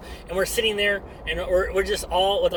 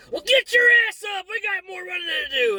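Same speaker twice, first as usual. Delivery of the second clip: sudden high-pitched bark, much higher and louder; drill sergeant yell; 12 semitones higher, 4 dB louder than usual. Retold or imitated. Drill sergeant ordering the recruit back up to run, high barking yell. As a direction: snap from mock comfort to a screaming order.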